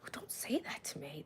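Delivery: whisper. Retold or imitated. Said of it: "I was like".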